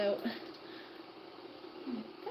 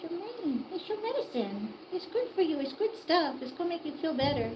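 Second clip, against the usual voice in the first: coaxing pet voice; 7 semitones higher than usual. Real, not acted. Owner coaxing the hen to take medicine, higher soothing baby talk. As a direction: sweet-talk the bird into it.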